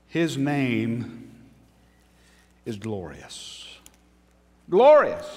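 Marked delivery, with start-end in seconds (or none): 4.7-5.3 emphatic exclamation. Real, not acted. Preacher repeats word emphatically.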